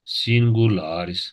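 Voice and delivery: singularis drawn out syllable by syllable; slow careful demo. Shown as a demonstration. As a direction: stretch out singularis for students. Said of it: "să învățăm numerele". (singularis rostit foarte rar)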